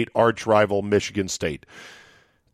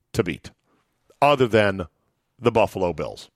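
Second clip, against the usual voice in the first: ordinary delivery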